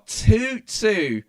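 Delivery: high, loud call of the score; a sudden thrilled yell of the equaliser; excited shout. Real, not acted. Score just equalised on stream.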